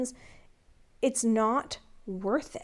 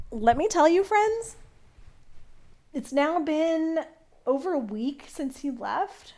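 excited; 5 semitones higher than usual. Real, not acted. Excited address to viewers.

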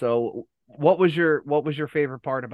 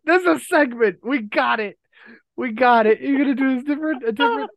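excited; 10 semitones higher, 5 dB louder than usual. Real, not acted. Thrilled at naming segment.

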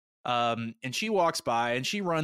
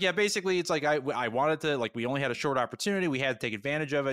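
fast excuses; 3 semitones higher, faster than usual. Retold or imitated. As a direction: hurry through the justification. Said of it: "he's like".

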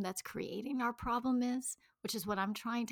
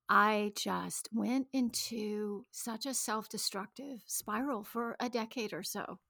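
normal talking voice

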